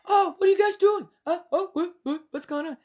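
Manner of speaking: high, quick mock voice of a bewildered queen, laughing; the reader mocking Athaliah, a high fast mock voice; flustered queen. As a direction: play her as clueless and flustered. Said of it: "She goes".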